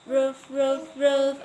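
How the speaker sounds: put-on loud, high woof woof for a toddler; playful dog barks. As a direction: bark it out like a cheerful puppy. Mother imitates dog for child.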